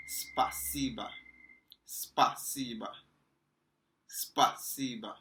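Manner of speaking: спасибо said slowly as spa-see-ba, falling at the end; Russian pronunciation. Shown as a demonstration. Teacher drilling спасибо for students, slow careful pron. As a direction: spell спасибо out slowly for learners. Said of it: "This means thank you".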